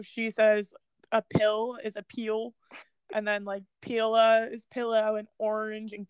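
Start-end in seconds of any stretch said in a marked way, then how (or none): 2.0-2.5 "pill" drawn out as "peel"; grandma's "pill". Retold or imitated. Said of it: "my grandma's really bad too". 3.8-4.5 "pillow" ending in uh, "pila"; grandma's "pillow". Retold or imitated. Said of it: "my grandma's really bad too".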